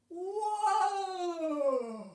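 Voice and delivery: loud high whoa to stop the horse; pretend whoa. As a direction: rein in the horse with a big call. Pretend horse ride stopping.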